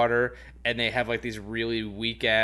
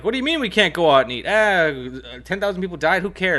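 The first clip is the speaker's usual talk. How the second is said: higher, louder entitled complaining; whiny entitled diner; 6 semitones higher, 6 dB louder than usual. Retold or imitated. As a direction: whine like a spoiled customer. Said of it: "you know, like".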